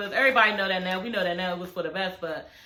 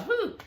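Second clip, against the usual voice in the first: high whew; 11 semitones higher, 4 dB quieter than usual. Real, not acted. Reacting to parents' divorce.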